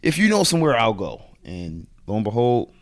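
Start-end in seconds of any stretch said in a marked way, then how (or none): 0.0-1.2 eager reply. Retold or imitated. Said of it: "And I was like".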